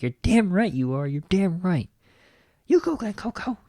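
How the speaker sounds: high, excited cheer of approval. Retold or imitated. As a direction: cheer them on, voice jumping up high. Cheering on the speech.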